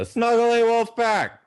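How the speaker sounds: high, loud team name call; announcer call. Shown as a demonstration. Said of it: "announcing loadouts".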